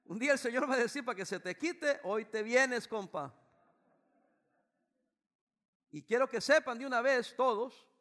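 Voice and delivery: loud, high warning; fervent preaching. Real, not acted. Preaching at full force.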